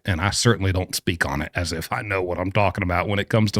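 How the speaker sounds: ordinary delivery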